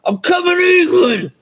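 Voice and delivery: hammed-up yelled threat from Snatch; shouted movie quote. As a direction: bellow it like an angry film gangster.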